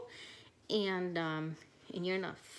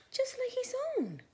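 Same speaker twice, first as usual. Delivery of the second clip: breathy whispered gasp of wonder; hushed amazed gasp; gasped discovery; faster than usual. A narrator's reading. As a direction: whisper it like a thrilling secret. Teacher reads Swimmy, gasping discovery.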